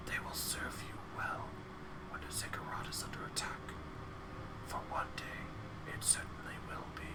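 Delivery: soft whispering chorus of skulls. Acted. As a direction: breathe every word as a whisper. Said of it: "their whispers blending together in a chorus".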